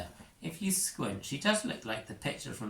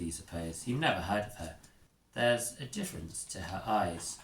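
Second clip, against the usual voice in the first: ordinary talk, nothing marked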